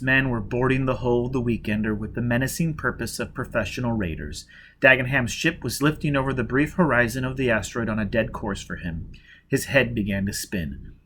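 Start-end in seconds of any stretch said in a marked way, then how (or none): none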